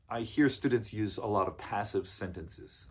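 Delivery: usual speaking voice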